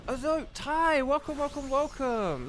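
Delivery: high, bright-pitched repeated welcome; excited welcome. Real, not acted. Streamer greets a viewer arriving in chat, excited high welcome. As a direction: sing out the welcome to a friend.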